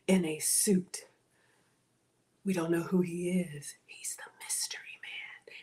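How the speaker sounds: secretive whisper; conspiratorial whisper; hushed whisper. Real, not acted. Hushed reveal of sponsor.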